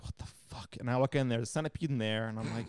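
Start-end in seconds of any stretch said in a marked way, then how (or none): 0.0-0.8 unvoiced, hushed disbelief; whispered what the fuck. Seeing the cat go nuts, whispered shock. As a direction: breathe it out in shock.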